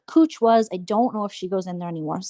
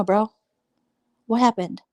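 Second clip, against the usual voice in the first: ordinary delivery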